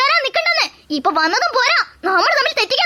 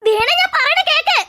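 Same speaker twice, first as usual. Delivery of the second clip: calling after her; 7 semitones higher than usual. Acted. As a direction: call her back as she walks away. Calling after wife walking away.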